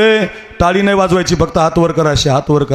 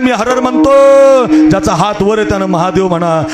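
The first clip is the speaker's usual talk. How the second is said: high, ringing sermon voice rallying the crowd; impassioned devotional preacher, raised pitch; fiery kirtan preaching; 7 semitones higher than usual. Acted. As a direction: preach it up to the crowd at full force. Preacher leads chant buildup.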